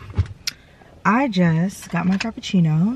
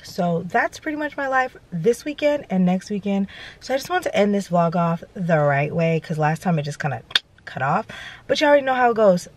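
ordinary talk

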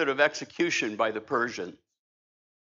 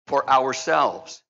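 normal talking voice

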